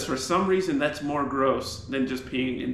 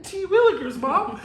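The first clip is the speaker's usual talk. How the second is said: put-on wholesome 1950s kid; 14 semitones higher, 4 dB louder than usual. Mock old-timey kid exclamation.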